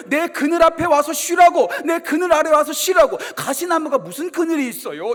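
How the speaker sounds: loud, rising pulpit warning; fiery sermon delivery; full-force preaching. Acted. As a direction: hammer home the warning like a preacher.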